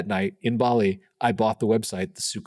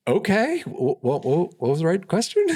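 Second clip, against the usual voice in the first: high, stammering, caught off guard; flustered surprise; 8 semitones higher, 3 dB louder than usual. Retold or imitated. Retelling how a client's answer threw him, stammering in high surprise. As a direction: stumble out the question, thrown.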